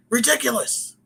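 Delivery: high-pitched scoffing exclamation; hammed-up disbelief; mock indignation. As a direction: act out a champion's outraged dismissal. Voicing wrestler's scornful boast.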